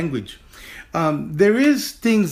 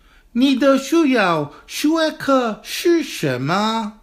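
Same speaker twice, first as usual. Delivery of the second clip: slow, higher, carefully articulated Chinese for students to repeat; slow model sentence; 8 semitones higher, slower than usual. Shown as a demonstration. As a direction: model each syllable slowly, teacher style. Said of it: "Chengfu repeat".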